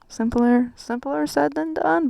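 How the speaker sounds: playful exclamation. Real, not acted. Teasing herself for the advice, high and loud. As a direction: laugh off your own advice. Mocking her own advice.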